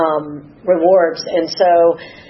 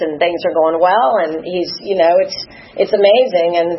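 ordinary talk

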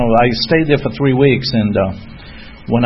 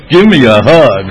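loud, mock-gruff truck driver greeting; booming demand; 3 semitones higher, 9 dB louder than usual. Old-timer demanding a hug from a newcomer, booming.

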